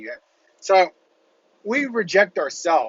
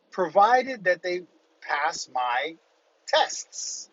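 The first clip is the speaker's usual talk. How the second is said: normal talking voice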